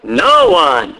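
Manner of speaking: "No one" stretched slowly and set apart; emphatic NO ONE. Shown as a demonstration. Preacher pointing out an emphatic negative, stressed NO ONE. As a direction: land firmly on "No one", then pause. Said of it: "another very emphatic negative here".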